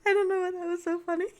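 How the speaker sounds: loud, high, helpless laughter; crying from laughing. Real, not acted. Streamer unsure why that was so funny, crying from laughing. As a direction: burst out laughing through the words. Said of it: "I'm literally crying from laughing".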